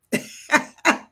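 loud, breathy burst of laughter; hearty laughter. Real, not acted. Laughs before proclaiming scripture.

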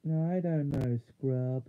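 plain reading voice

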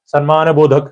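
ordinary talk

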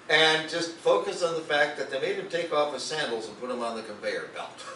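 usual speaking voice

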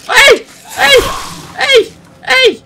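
very high loud hey; repeated shouts. Real, not acted. Gamer shouts at enemies rushing him, loud high hey. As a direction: yell each one to get their attention.

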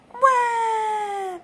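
high, loud drawn-out crying "wah"; loud wail. A narrator's reading. George cries over a broken toy, high loud wail. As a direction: wail like an upset little one, long and high. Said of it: "cries George".

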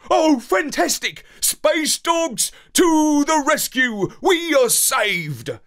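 high, loud joyful cheer. A narrator's reading. Dog cheering the space dogs, high and loud. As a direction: cheer like a rescued pup. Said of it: "I cheered".

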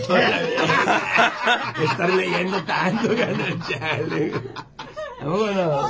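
words riding on laughter; slow, drawn-out talk through laughs; laughing. Real, not acted. Comic capping his reading joke to his pal, laughing.

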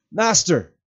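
high, loud shout into the wind. A narrator's reading. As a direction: shout with gathered breath. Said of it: "once again he shouted".

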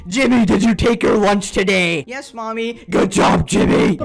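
screaming mother praising her son; mock screaming mom. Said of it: "everyday conversations with this woman".

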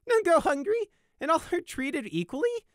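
very high, loud incredulous echo; gleeful high-pitched ridicule; laughing mockery. A narrator's reading. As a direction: throw the words back through giggles. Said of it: "he laughed".

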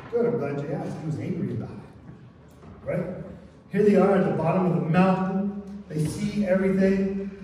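usual speaking voice

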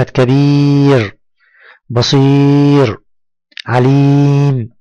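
كبير، بصير، عليم with very long ee; long yaa madd. Shown as a demonstration. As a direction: hold each ee steady and long. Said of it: "مد بالياء". (مدّ الياء طويلًا في كبير وبصير وعليم)

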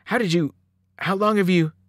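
flustered and halting; stammered. A narrator's reading. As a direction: start asking, lose it, start over. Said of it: "I stammered".

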